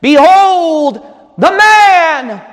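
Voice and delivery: loud, slow, high proclamation to a crowd; Pilate's proclamation. Acted. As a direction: present him with long, ringing words. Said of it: "and Pilate saith unto them".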